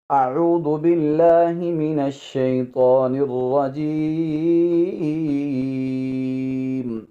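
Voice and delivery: very slow, melodic Quran recitation; Quranic recitation. Acted. Teacher opening the lesson, slow chanted recitation. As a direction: intone each word long and measured.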